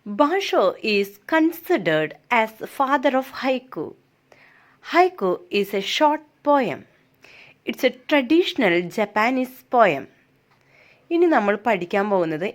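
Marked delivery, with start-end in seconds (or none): 0.0-10.0 slow, deliberate classroom reading; reading aloud. Shown as a demonstration. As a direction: read each word out slowly for the class. Teacher reads English text aloud. (പതുക്കെ വായിക്കുന്നു)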